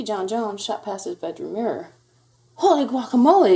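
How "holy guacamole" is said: loud, high-pitched exclamation; startled kitten's outburst; exclaiming. A narrator's reading. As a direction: cry out in astonishment. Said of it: "exclaimed Skippy John Jones".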